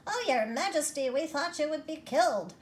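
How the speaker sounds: relieved little mice crying out to their queen; exclaiming mice. Acted. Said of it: "they exclaimed".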